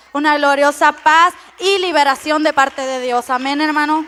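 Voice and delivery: loud, emphatic sermon; fiery preaching. Real, not acted. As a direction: preach it with conviction. Preaching to congregation.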